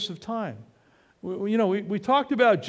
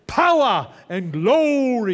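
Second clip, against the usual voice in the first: Pentecostal preaching; 4 semitones higher, 6 dB louder, slower than usual. Shown as a demonstration. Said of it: "Pentecostal way".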